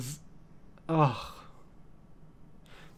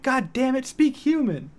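high, louder quoted yell; mock yell; 10 semitones higher, 4 dB louder than usual. Retelling the sketch line to chat, high mock yell. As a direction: shout it at the dog again. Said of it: "but, like".

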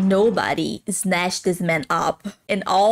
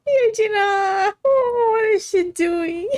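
embarrassed cringe; 10 semitones higher than usual. Real, not acted. Cringing at character's awkward behaviour.

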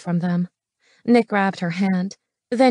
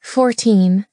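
usual speaking voice

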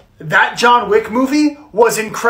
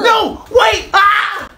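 high shouted protest into a scream; panicked shout; 10 semitones higher, much slower than usual. Acted. Nerd being hauled out by security, panicked screaming. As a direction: yell for them to stop, then scream.

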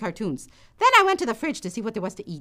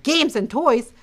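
put-on eager toddler; 7 semitones higher, 6 dB louder than usual. Toddler wanting to join.

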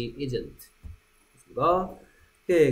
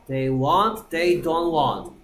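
slow drill; 4 dB louder, much slower than usual. Shown as a demonstration.

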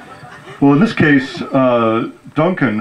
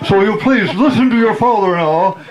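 lecturing father; 6 semitones higher than usual. Retold or imitated. Father lecturing on men and women.

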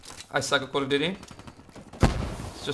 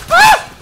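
piercing high shriek; sudden terrified scream; 12 dB louder than usual. Real, not acted. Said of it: "That was the manliest scream".

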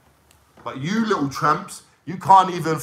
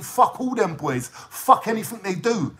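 ordinary delivery

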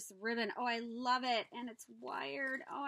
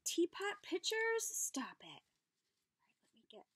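hushed whisper of disbelief; awed whisper; 6 semitones higher, 3 dB louder than usual. Real, not acted. Finding tiny teapot pitchers, awed whisper. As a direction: breathe it out in wonder.

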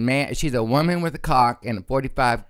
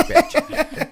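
sassy retold snap, pitched way up on bitch; sassy high bitch. Retelling snapping at a trick, bitch pitched way up and sassy.

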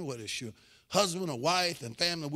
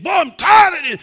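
loud, high exasperated shout; acted fed-up parental yell; blown-up parent; 13 semitones higher, 17 dB louder than usual. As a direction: explode at the child, all patience gone. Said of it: "about to blow your stock".

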